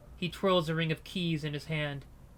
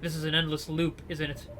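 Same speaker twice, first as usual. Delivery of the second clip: ordinary delivery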